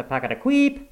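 chugging that leaps to a high squeak; imitated machine noise. A narrator's reading. Narrator imitating the failing machine, high-pitched squeak. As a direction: mimic a faulty machine squeaking. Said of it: "which was now going".

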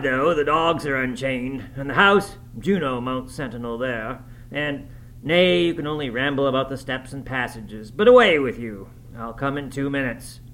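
Heathcliff ordering the guest away, bursts of loud high voice; forceful character voice, surging on warnings; impatient host. A narrator's reading. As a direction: send him off with brusque commands.

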